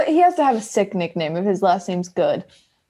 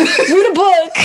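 higher, louder movie comeback through laughter; mock exasperated quote; 8 semitones higher, 6 dB louder, slower than usual. Finishing the Superbad quote for co-host, mock exasperated. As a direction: toss out an imitated put-down, cracking up. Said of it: "He goes".

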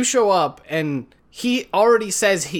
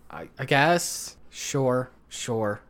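softer, trailing off, resigned; 3 semitones lower, 6 dB quieter, much slower than usual. Retold or imitated. Heroes shrugging at the plan, resigned and fading. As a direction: give up and fade out.

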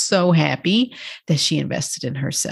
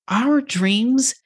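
usual speaking voice

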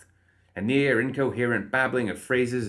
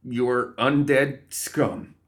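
slow, louder, wobbling insult; wobbly defiance; 4 semitones higher, 5 dB louder, much slower than usual. A narrator's reading. Trembling guard defying the undead, wobbly and determined. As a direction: force it out slowly, higher, voice wobbling. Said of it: "The guard's voice wobbled".

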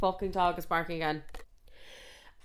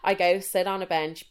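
ordinary talk

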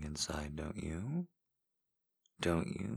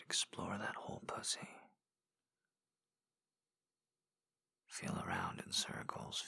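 intimate ASMR whisper; 3 semitones higher than usual. Acted. Voice in a lover's ear, guiding in whisper. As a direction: coax them on in a hushed whisper. Erotic audio whispered guidance.

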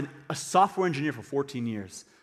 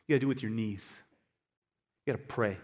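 ordinary delivery

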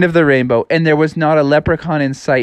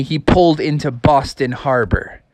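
usual speaking voice

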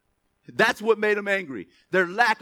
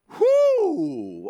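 exultant whoop; 5 semitones higher, 4 dB louder than usual. Real, not acted. Preacher exults.

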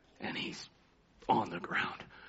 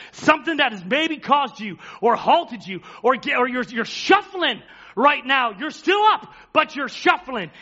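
rising preacher's call, lightly pushed; building sermon appeal; 12 dB louder than usual. Acted. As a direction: press the congregation toward God. Preaching at full force.

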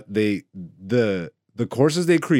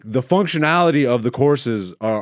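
ordinary talk, nothing marked

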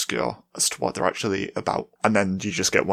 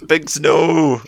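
scolding shout; 6 semitones higher, 12 dB louder than usual. Real, not acted. Scolding cat off the microphone.

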